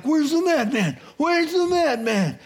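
high, loud, disbelieving cry; a crowd searching in amazement; astonished crowd. Retold or imitated. Townsfolk looking for the madman, high astonished call. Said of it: "And they're like".

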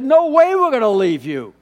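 cling to her, won't let her go; pleading daughters-in-law. Retold or imitated. Daughters-in-law refusing to leave Naomi, loud and pleading. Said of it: "They said".

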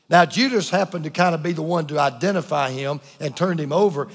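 normal talking voice

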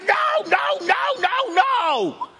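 shrill, loud, high-pitched protest; exasperated king. Acted. King protesting the prophet's extra blessing, loud exasperated yell. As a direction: howl it like he's being robbed.

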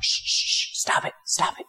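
urgent hushing whisper; shushing whisper. Retold or imitated. Crowd hushing the blind beggar, in an urgent whisper. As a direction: hiss them quiet, fast. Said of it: "the people around him are like".

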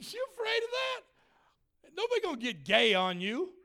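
mocking challenge. Mocks people's fear of gays.

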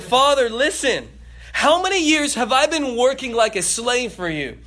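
son confronting his father, indignant and loud; angry son. Acted. Older son getting in father's face, angry. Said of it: "He gets back in his father's face".

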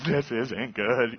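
slow, drawn-out mock sob; imitated sobbing. Hezekiah weeping before God, slow mock sob. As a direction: stretch the words out like a half-sobbing man. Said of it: "you gotta do that certain sobbing that you see".

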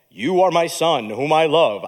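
only a hint of a slow, booming God voice; lightly voiced heavenly father; deep voice of God. Shown as a demonstration. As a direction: announce it like the voice from heaven. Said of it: "why God always speaks in a, in a low voice".

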